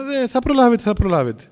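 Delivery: starts high and loud, then drops; emphatic retold reassurance; breezy dismissal. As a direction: brush the worry aside. Elder dismissing the worry.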